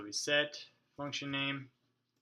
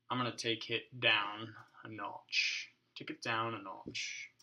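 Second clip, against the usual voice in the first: slow, lower-pitched mock voice; drawn-out, put-on talk, pitched down; put-on silly voice; 3 semitones lower, 5 dB quieter than usual. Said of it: "I wasn't gonna talk like that".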